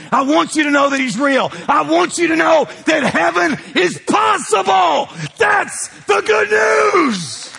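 rising from earnest to a full preaching shout; preacher swelling into a roaring cry; building to a shout. Acted.

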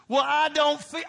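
high, loud mocking whine; churchgoer's excuse mocked by the pastor; whiny mock excuse. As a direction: mimic the lame excuse. Mock counter response of listener.

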